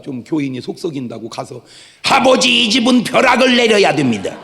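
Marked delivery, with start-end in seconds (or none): 2.0-4.4 높고 크게 벼락을 빌며 외치기; 교인에게 화난 목사처럼 울부짖듯 기도; 분노의 기도 흉내. Retold or imitated. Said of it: "그렇게 기도하는 목사가". (교인 때문에 속상한 목사가 하나님께 벼락을 청하며 외치는 흉내)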